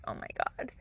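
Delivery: whispered under the breath; hushed, voiceless aside; dismayed whisper. Retold or imitated. Retold reaction under her breath.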